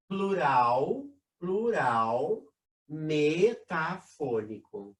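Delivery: metafônico drawn out syllable by syllable; slow, spelled-out term. Shown as a demonstration. Teacher dictating metafônico slowly to students. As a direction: stretch out metafônico slowly so students can note it. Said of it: "a gente chama de plural metafônico".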